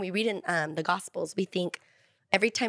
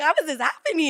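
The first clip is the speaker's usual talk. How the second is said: retold tearful amazement; 7 semitones higher, 5 dB louder than usual. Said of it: "sometimes I'm crying 'cause I'm like".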